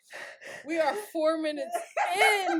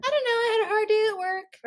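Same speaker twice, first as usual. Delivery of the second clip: mock girl reply; 8 semitones higher, 3 dB louder than usual. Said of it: "It's gonna be like".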